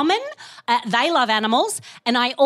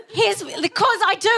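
ordinary talk, nothing marked

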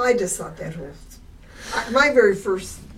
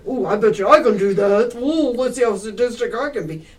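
louder, gloating voice of a would-be killer; sadistic viewer; 3 semitones higher, 6 dB louder than usual. Acted. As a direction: brag it like a creep daring himself.

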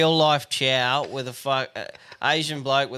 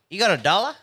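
lightly acted, higher-pitched pestering question; pestering cadger; 6 semitones higher, faster than usual. Retelling how a dealer begged for a dollar, mock pestering. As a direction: imitate a cadger asking, pitch lifting.

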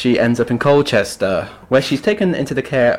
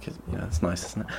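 sarcastic aside; 3 semitones lower, 14 dB quieter, faster than usual. Real, not acted.

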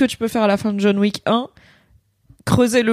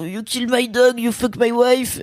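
dumb action hero parody; 2 semitones higher, slower than usual. Retold or imitated. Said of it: "et qui va jouer l'idiot".

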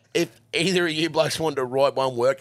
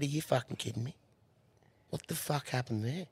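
quiet disbelief; 13 dB quieter than usual. Retold or imitated. Asking the fallen rider what happened, quiet disbelief. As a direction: murmur it, dumbfounded. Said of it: "I said".

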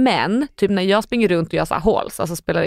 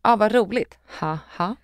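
sour sarcasm. Shown as a demonstration. Reading a text message haha as sour, sarcastic. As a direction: say it sourly, letting the laugh drop away. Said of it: "då är man ju sur".

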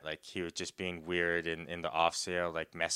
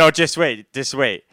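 insistent; 5 semitones higher, 14 dB louder than usual. Real, not acted. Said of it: "teasing listener".

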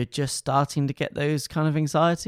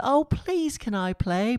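lovely little armadillo voice; 8 semitones higher, slower than usual. Retold or imitated. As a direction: beg to join the game like a tiny sweet animal, much higher. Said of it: "He's got a lovely little voice".

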